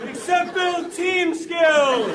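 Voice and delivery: very high, slow comic shout; exasperated shout. Retold or imitated. Kid stuck with the gym parachute, shouting. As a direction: rant at the useless parachute. Ranting about useless parachute.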